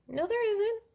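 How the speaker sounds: high, disbelieving no, there isn't; shocked denial. Retold or imitated. Patient retelling disbelief at the doctor's lump finding, shocked denial. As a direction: push back in disbelief at the news.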